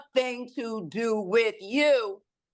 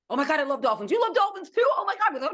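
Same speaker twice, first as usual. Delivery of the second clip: high, giddy squeal with a laugh; gushing excitement; 9 semitones higher, faster than usual. Retold or imitated. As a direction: gush like you just found a fellow fan. Gushing over a shared love.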